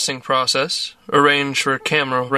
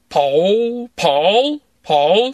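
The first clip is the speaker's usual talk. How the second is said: trying out tones; 4 semitones higher, much slower than usual. A narrator's reading. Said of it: "different tones of voice".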